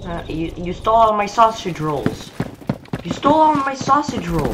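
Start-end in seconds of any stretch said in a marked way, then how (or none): 3.0-4.5 higher-pitched, stress on all; higher indignant repeat. Shown as a demonstration. Gamer accusing the thief again, higher and outraged. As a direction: push it up in outrage. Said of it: "repeats the line".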